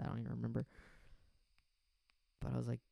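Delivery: usual speaking voice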